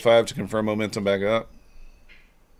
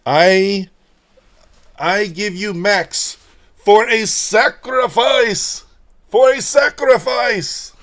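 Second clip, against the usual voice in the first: mock ceremony; 12 semitones higher, 9 dB louder, much slower than usual. Mock offering the cat.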